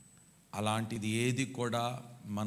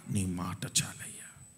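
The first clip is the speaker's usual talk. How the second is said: whispered prayer; 6 semitones lower, slower than usual. Acted. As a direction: drop to a whisper. Same prayer then whispered. (గుసగుసగా ప్రార్థన)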